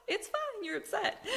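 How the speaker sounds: high, soft, placating voice; gently indulgent high murmur; retold soothing reassurance. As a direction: soothe softly, holding back a laugh. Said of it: "And I was like".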